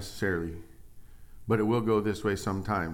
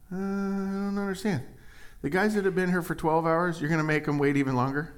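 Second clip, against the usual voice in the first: higher, baffled voice; a hint of puzzled worker; 8 semitones higher than usual. Puzzled laborer questioning the owner, higher voice. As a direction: play dumb, confused.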